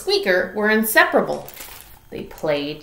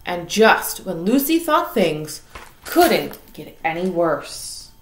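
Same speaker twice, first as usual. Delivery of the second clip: plain reading voice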